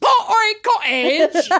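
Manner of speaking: high, posh-leaning mock British "Pottery Cottage"; hammed-up British accent. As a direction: ham up "Pottery Cottage" like an American faking a Brit, pitched high. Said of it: "in a British accent".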